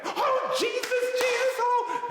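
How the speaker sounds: very high, loud ecstatic crying out to Jesus; Holy Ghost shouting. Retold or imitated. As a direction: cry out to the Lord in rapture. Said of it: "she went into a Holy Ghost".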